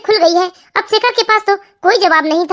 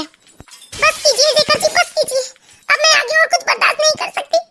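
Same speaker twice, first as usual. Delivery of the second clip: high-pitched, anguished plea to stop; distraught; 7 semitones higher than usual. A narrator's reading. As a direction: beg him to stop, voice pitched right up. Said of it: "Shekhar की बातें आरती बर्दाश्त नहीं कर पाती". (व्याकुल, ऊँचे सुर में गिड़गिड़ाती पत्नी)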